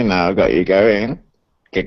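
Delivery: ordinary talk, nothing marked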